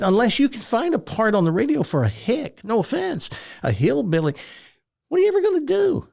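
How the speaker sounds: high-pitched mock disbelief; incredulous. Teacher doubting a hillbilly's radio prospects, incredulous. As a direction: mimic a doubtful teacher, climbing to a squeak.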